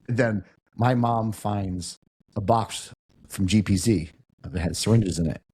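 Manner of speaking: ordinary delivery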